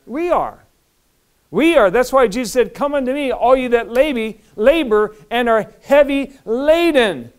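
high, loud pulpit declaration; preaching. Real, not acted. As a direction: proclaim the verse to the congregation. Preaches at full force.

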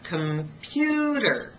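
slow computer with pu hit hard; slow teacher demo of the stress on pu. Shown as a demonstration. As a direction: stretch computer out and punch pu. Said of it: "It's the middle one".

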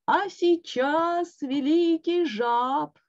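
announcer voice. A narrator's reading. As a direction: stretch out the grand intro. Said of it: "начал было голос".

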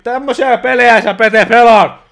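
loud, high indignant yell; outraged shout. Real, not acted. Grandfather scolding Pete over the game, outraged shout. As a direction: scold him at full volume.

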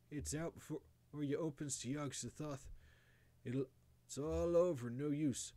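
halting, urgent deathbed warning in country dialect; dying old grandfather. A narrator's reading. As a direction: stumble through last orders to a grandson. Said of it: "he had another sentence or two".

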